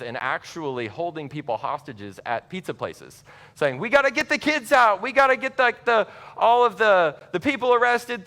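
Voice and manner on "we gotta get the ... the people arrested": high, loud imitation of frantic rescuers; urgent shouting. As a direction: shout it like an alarmed vigilante. Panicked gunmen demanding kids' rescue.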